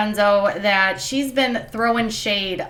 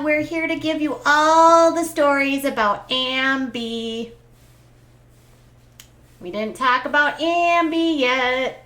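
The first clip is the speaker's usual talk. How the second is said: usual speaking voice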